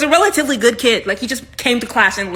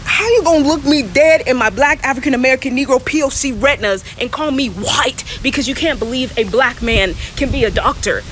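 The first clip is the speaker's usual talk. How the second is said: indignant rant; 2 semitones higher, 3 dB louder than usual. Pretend. Indignant rant, far, sung.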